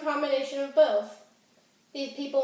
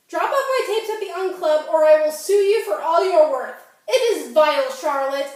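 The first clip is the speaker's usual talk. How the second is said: indignant and loud, pitched up; angry threat; 3 semitones higher, 7 dB louder than usual. Acted. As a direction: threaten your friend at full voice. Acted threat to friend on phone.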